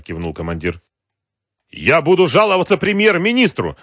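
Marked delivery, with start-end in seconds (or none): 1.7-3.8 high, loud indignation; angry threat. A narrator's reading. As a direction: threaten them in outraged full voice. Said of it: "гневно сказал президент". (гневно, громко и высоко)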